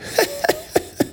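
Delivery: sudden high laugh; squeaky laugh; high burst of laughter. Real, not acted. Laughs at gif.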